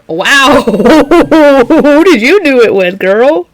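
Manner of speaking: put-on amused girlfriend gossip; laughing wow. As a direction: tease through a laugh. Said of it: "I'm like".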